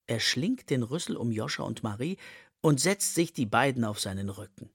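ordinary delivery, nothing marked

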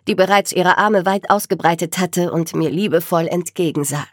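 ordinary delivery

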